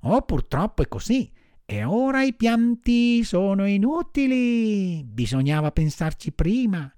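high marmot voice, regretfully scolding; high-pitched animal reproach; admonishing marmot. A narrator's reading. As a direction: chide the puppet from up high, then settle.

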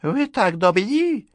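put-on slow, high, toothless old lady; mumbling old woman. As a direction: mumble politely like a toothless widow. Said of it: "учтиво прошамкала старуха". (шамкающая старуха)

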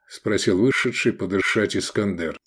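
ordinary delivery, nothing marked

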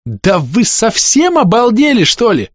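high, slow, enraged yell; furious yell. Acted. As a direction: blow up at them, furious. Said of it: "Вопль разъярённой баронессы".

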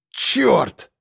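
high, tight curse; muted bark. A narrator's reading. Narrator turning back into the fire, muted bark. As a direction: curse through barely open lips. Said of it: "рявкнул я, почти не размыкая губ".

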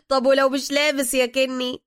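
high, loud laugh into question; cackle, then ask it merrily. A narrator's reading. Alaa jokes with Kenny about the victim, laughing. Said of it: "علاء ضاحكا".

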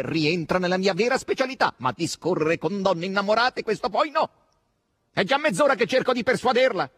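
high, loud, mounting exasperation; exasperated. A narrator's reading. Asasello half an hour persuading her, exasperated. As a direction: complain with rising impatience.

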